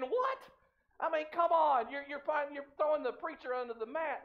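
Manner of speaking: high-pitched, incredulous protest; mock indignation. Preacher reacting to his fishing buddy's jibe, mock indignant. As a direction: play up the affronted disbelief.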